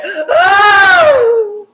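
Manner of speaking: high shouted crying wail; mock wailing cry. Huda breaking down in the villa, high wailing cry.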